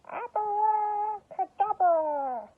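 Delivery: very high, loud, drawn-out incantation; spell-casting shout. Acted. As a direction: cast the spell with full drama. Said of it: "waved her magic wand and shouted".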